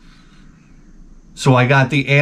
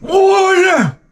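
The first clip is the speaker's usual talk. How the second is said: high strained yell for water; desperate cry; 3 dB louder than usual. Retold or imitated. Parched mouth begging for water, high yelled. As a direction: cry out like a man in a desert. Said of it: "My mouth was like".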